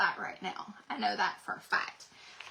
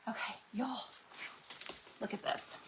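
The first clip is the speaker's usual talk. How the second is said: much softer, almost whispered marvel; hushed awe; 11 dB quieter than usual. Real, not acted. Crafter hushing to viewers over a pretty transfer, softly. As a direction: lean in and hush in wonder.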